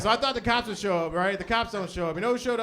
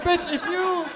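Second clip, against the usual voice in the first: higher, mock yell at her; exasperated yell; 8 semitones higher than usual. Comic yelling at the uninsured driver, exasperated. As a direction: tell her off in disbelief.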